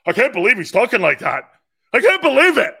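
loud, high-pitched mock outrage; hammed-up hysterical disbelief, rising to a high yell; outraged maniac liberal. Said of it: "some maniac liberal thinks that".

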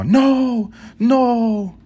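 put-on high, loud old lady cry of no; imitating grandma. Grandson retelling grandma calling out, high and loud. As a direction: call out like a distressed grandmother. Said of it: "More Spanish accent".

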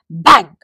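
loud mock bang. A narrator's reading. Narrator acts the spoon hitting the squirrel, loud bang. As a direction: punch out a sound effect.

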